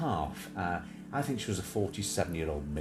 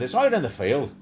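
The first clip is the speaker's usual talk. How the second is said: louder imitated Northern Irish phone agent; Northern Irish accent; 4 semitones higher, 7 dB louder than usual. Imitating the phone company woman, in Northern Irish. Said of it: "And she said".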